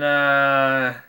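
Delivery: normal talking voice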